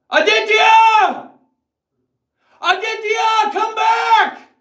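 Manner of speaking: very high, loud, drawn-out call after him; calling out far. Acted. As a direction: holler across the distance. Calling after departed friend.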